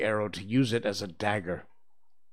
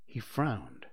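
ordinary delivery

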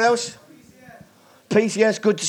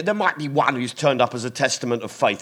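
ordinary talk, nothing marked

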